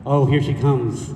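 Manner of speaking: ordinary talk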